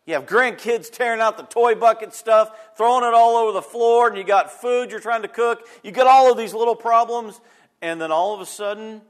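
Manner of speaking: loud, fast-building sermon delivery; fervent preaching. Acted. Preaching at full force.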